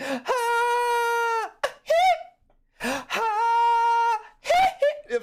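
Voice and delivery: very high, loud yelped "Ah, hit"; high, loud mock pop-star yelps. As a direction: shriek it like the Hunter lunging. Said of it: "he's like".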